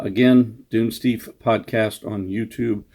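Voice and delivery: ordinary delivery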